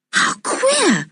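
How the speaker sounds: high, astonished exclamation; surprised. A narrator's reading. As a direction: sound amazed. Julian finds the dog down the hole.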